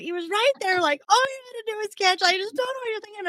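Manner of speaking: high, exasperated mock rant; imitated going off. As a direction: rattle off a mock telling-off. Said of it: "he just goes off".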